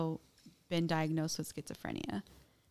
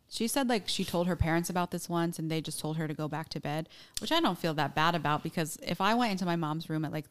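ordinary talk, nothing marked

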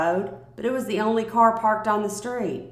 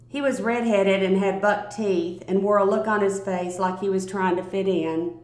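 usual speaking voice

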